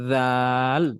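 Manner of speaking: tongue lightly out on "রাল", then back for l; monotone held "রাল", said dhaal; tongue-out dhal. Shown as a demonstration. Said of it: "জিহ্বাটা একটু হালকা বাইর করে".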